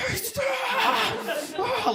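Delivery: imitating an overexcited child's very high squeal; so excited. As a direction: squeal it way up high, like a thrilled kid. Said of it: "like, he's so excited".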